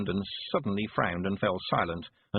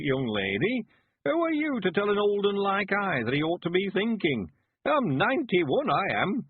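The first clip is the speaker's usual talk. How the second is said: put-on high, spry old countryman's voice; a stubborn old man who won't be told; high rustic old man, full of pluck; old Mister Weevil; 8 semitones higher than usual. A narrator's reading. Old villager Weevil's speech.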